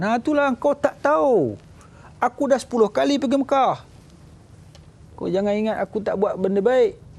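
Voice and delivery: high, haughty sneering boast; boastful rich man. Retold or imitated. Rich man brags to the preacher, high haughty sneer. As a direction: lord it over the one asking.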